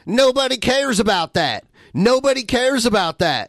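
high, mocking repeat of the line; mocking debate tactic. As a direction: parrot it back in a mocking voice. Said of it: "this is my new debate tactic".

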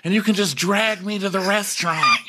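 put-on high goofy boyish voice; doofy Shep. Shep begging to be dragged on his skateboard, high goofy. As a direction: ask it like an eager kid. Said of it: "And he's like".

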